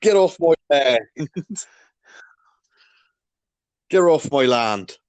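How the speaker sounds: West Country farmer. Pretend. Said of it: "I'm a farmer".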